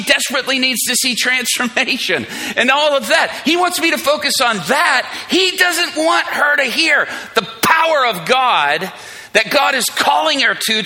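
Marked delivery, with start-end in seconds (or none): 5.3-10.8 preaching intensity. Acted. Preaching at full force.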